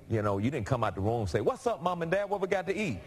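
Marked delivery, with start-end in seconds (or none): 1.4-3.0 high-pitched mock greeting to the parents; joking, high-voiced baby demand; pretend talking baby. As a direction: pipe up high like a cheeky newborn. Voices a newborn speaking fluently.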